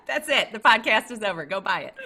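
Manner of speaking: loud, laughing wrap-up joke; playful excited. Real, not acted. Host loudly jokes the podcast is over and sends listeners to buy, laughing. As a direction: end the show as a joke, still laughing.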